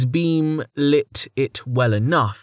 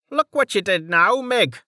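Dell's voice; 9 semitones higher than usual. Acted. Dell blaming Mig.

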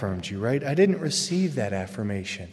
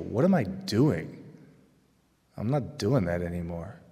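mock bored kid; 6 semitones lower than usual. Voicing a bored, disillusioned kid.

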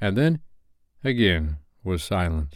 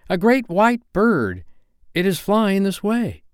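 high, wondering announcement; excited cry; 11 semitones higher, 3 dB louder than usual. A narrator's reading. Nibs reporting a strange bird to the boys, high excited cry. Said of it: "he cried".

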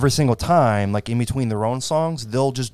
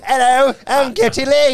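high, loud put-on European-ish accent; 14 semitones higher, 6 dB louder than usual. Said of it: "That's not how they talk in Canada".

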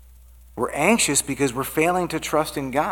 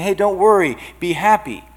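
higher, louder mock-cheery brush-off; glib reassurance; 6 semitones higher, 7 dB louder than usual. Preacher mimicking easy advice to the anxious, glib and loud. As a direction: toss it out as breezy, empty advice.

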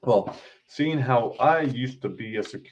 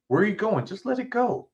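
put-on much higher, pleading girl's voice; Luna's voice; 11 semitones higher than usual. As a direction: rise way up to play Luna begging him to stop. Narrator voices Luna pleading.

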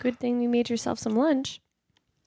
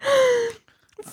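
high, loud cry after a burn; pained yelp; 12 semitones higher, 4 dB louder, slower than usual. Real, not acted. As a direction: yelp at a scalded tongue. Burned tongue on hot soup.

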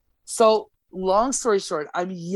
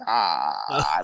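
mock low scoff. Brushing off homeowner's accusation.

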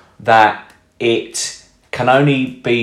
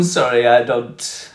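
put-on Russian accent; slower than usual. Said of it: "Bad Russian accent there".

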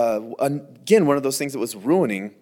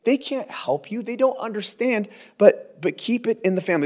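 urgent pleading; 7 semitones higher than usual. Retold or imitated. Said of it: "Paul says".

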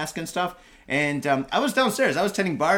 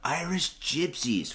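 mock band name; 5 dB quieter, much slower than usual. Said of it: "it sounds like a great band".

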